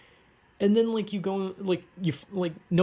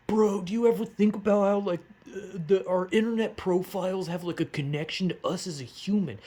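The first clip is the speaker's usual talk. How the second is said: higher, spaced-out stoner pondering the internet; dreamy stoner voice; 5 semitones higher than usual. Retold or imitated. Vlogger imitating a stoned bro musing about the internet, higher and dreamy. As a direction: drift into a hazy stoned bro wondering aloud. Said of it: "that's basically what I'm doing".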